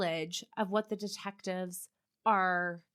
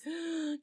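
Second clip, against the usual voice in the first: sudden shocked intake of breath; sharp gasp; 8 semitones higher than usual. Real, not acted. Shocked reaction to victim list.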